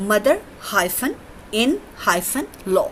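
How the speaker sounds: mother hyphen in hyphen law read very slowly; slow spelled out. Shown as a demonstration. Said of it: "example है यहाँ mother in law". (mother hyphen in hyphen law धीरे धीरे, हर hyphen बोलकर)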